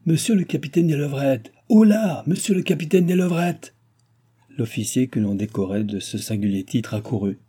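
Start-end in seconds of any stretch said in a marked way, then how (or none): 0.0-3.7 high, loud calling-out; shouting. A narrator's reading. King shouts to drown out his daughters, shouting. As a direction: holler for the officer, peaking on "Oh là". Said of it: "en criant pour ne pas entendre".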